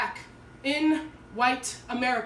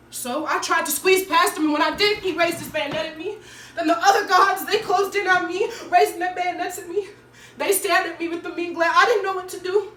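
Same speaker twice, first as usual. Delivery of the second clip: louder, higher, agitated storytelling; mounting alarm; 6 semitones higher, 7 dB louder than usual. Acted. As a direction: tell it as if cornered by armed guards. Acted account of being cornered.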